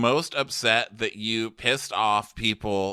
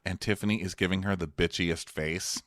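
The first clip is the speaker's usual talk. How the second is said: ordinary delivery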